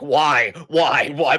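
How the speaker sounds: mock snap at someone, repeated; imitated angry snapping. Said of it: "Orton just snapping on him like".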